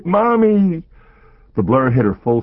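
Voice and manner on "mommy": high, loud terrified shriek; child's scream. A narrator's reading. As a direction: scream for mommy in terror. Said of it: "her daughter's cry".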